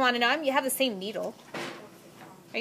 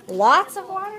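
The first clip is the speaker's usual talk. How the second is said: surprised; 9 semitones higher, 8 dB louder than usual. Real, not acted. Teacher surprised by student answer.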